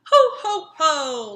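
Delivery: belly-laugh it out like Santa; Santa's ho ho ho. A narrator's reading. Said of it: "laughed Santa".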